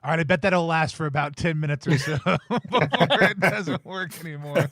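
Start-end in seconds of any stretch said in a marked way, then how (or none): 2.2-4.7 chuckling through the words; laughing. Real, not acted. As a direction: laugh while repeating the line. Echoing the GM's ruling while laughing.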